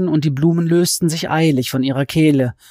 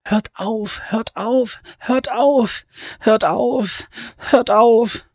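high, strained desperate cry; choked scream; 8 semitones higher, much slower than usual. Acted. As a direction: beg through choking roses. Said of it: "um zu schreien".

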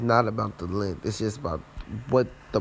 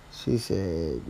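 ordinary talk

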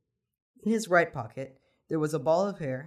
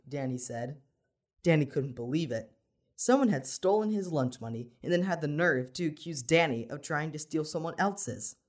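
ordinary delivery, nothing marked

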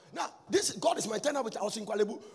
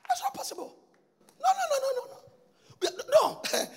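incredulous cry; 13 semitones higher, 7 dB louder than usual. Acted. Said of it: "emphatic denial".

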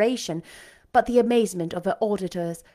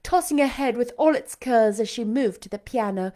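ordinary delivery, nothing marked

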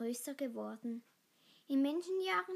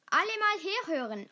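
raised, loud call to the group; 6 semitones higher, 8 dB louder than usual. A narrator's reading. Pack leader addresses all the wolves, calling out. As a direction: call everyone to attention.

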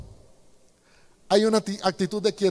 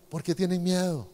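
gentle question; 2 semitones lower, 7 dB quieter than usual. Acted. Jesus asks gently, not harshly.

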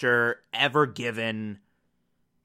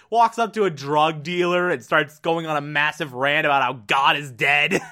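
ordinary delivery

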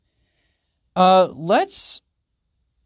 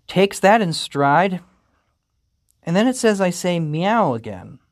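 ordinary talk, nothing marked

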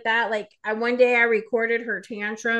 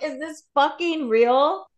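higher, slow disbelieving question; incredulous; 6 semitones higher, 3 dB louder, slower than usual. Retold or imitated. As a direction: drag it out in mock disbelief. Parent baffled by child's behaviour.

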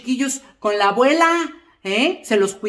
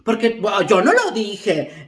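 ordinary talk

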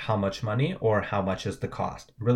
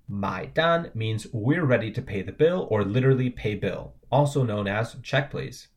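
usual speaking voice

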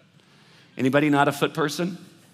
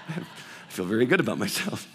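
laughing smugly; 6 semitones lower than usual. Retold or imitated. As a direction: chuckle at your own pride. Laughing at own smugness.

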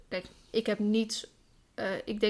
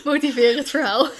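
laughing; 3 semitones higher, 4 dB louder than usual. Real, not acted. Laughing at the failed sneeze.

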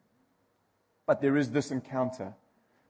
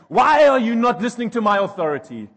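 much higher, louder furious demand; red-faced rage; 10 semitones higher, 12 dB louder than usual. Retold or imitated. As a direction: blow up like a short plump man. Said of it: "blood red in the face".

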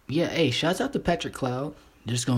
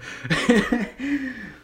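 loud high-pitched laugh; high cackling laugh; 12 semitones higher, 4 dB louder than usual. Real, not acted. Laughs at own joke.

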